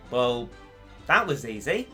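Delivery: normal talking voice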